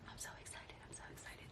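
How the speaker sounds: breathless whisper, barely holding it in; whispered excitement. Real, not acted. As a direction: whisper it, trying to contain your excitement. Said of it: "Trying to contain myself".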